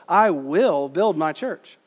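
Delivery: "will" drawn out and punched, slow line; stress on will. Shown as a demonstration. Preacher showing the congregation the emphasis, stress on "will". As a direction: hit the word "will" and hold it. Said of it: "emphasize the word will".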